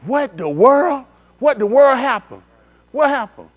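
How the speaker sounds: high, loud, disbelieving "What the world?"; shocked reaction. Acted. Preacher showing the congregation the natural first reaction in loud high shock. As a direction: act out the shock of the accident. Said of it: "the natural reaction is to say".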